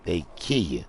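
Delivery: ordinary talk, nothing marked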